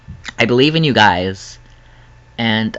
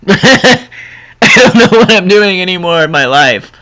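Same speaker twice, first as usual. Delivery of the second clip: laughing it off, voice up high; 7 semitones higher, 6 dB louder than usual. Real, not acted. Vlogger laughing at her own awkwardness, laughing. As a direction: giggle through the words.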